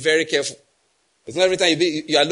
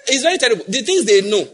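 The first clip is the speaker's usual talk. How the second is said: laughing through the words, louder and higher; 5 semitones higher, 6 dB louder than usual. Real, not acted. Preacher laughing at nervous exam students. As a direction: laugh while you say it, amused.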